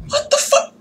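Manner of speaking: a high, shocked yelp of disbelief; startled squeal. Real, not acted. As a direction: squeak the words out, caught off guard. Game glitches in front of him.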